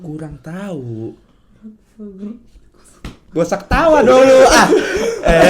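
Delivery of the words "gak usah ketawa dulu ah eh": laughing. Real, not acted. Scolding others for laughing, laughing.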